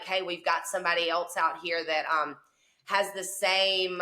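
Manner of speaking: ordinary talk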